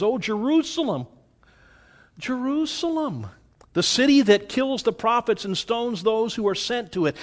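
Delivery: high, grieving cry over the city; lamenting Jesus. Acted. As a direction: mourn Jerusalem like a heartbroken prophet. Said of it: "he breaks down and he weeps".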